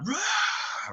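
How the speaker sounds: high-pitched wordless roar; Beast's manly roar. Acted. Furious Beast before storming off, roar. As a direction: let out a big roar. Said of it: "roars a very big, manly roar".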